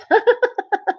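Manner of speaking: high, light burst of laughter. Real, not acted. Streamer amused by a viewer's chat message, laughing. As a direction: break into a quick high giggle.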